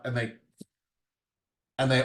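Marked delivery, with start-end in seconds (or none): none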